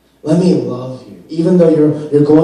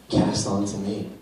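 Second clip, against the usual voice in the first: much softer and lower, close and comforting; soft reassuring Christ; 4 semitones lower, 10 dB quieter than usual. Pretend. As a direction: hush it down like a reassuring promise. Christ voiced taking burdens.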